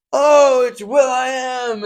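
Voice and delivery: high, loud, slow reveal; hammed-up audience member; mock excited guess. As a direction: gasp out the celebrity guess. Audience guessing on Masked Singer.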